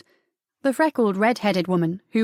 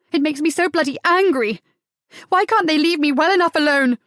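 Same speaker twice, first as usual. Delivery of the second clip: higher, louder furious complaint; angry outburst; 6 semitones higher, 4 dB louder than usual. Acted. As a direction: let the rage burst out as she kicks. Sarah kicking the wall in fury.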